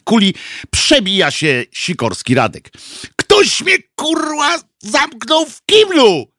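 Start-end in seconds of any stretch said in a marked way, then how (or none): 3.2-6.3 very high, strained complaint; outraged protest. A narrator's reading. Sikorski bursts in late after being locked in, outraged. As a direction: wail it in high outrage, stretching the end.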